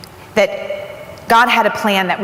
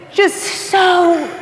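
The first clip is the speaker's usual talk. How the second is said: hysterical; 11 semitones higher than usual. Retold or imitated. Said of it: "so hysterical".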